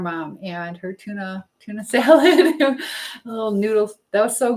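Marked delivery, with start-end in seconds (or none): none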